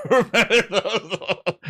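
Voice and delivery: laughing. Real, not acted. Laughing reminiscence.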